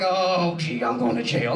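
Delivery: low mock-glum imitation of a busted man; lightly hammed-up dismay; dismayed caught man. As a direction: groan it low, as if resigned. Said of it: "And the guys are like".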